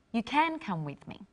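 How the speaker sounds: can lifted and stretched; stress on CAN. Shown as a demonstration. Teacher repeats with stress on can. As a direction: lean on the word can. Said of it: "but now you can".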